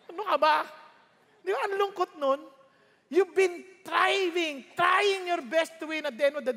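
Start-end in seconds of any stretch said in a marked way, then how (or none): none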